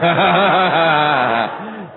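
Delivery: a real laugh bursting out loud; hearty laugh. Real, not acted. Preacher laughs loud at his own joke to the congregation, hearty laugh.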